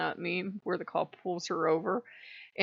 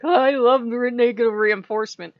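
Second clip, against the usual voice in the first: loud, higher gushing Marine voice; 4 semitones higher, 9 dB louder than usual. Retold or imitated. Slap-happy Marine gushing about negative reinforcement, loud. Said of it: "They're like".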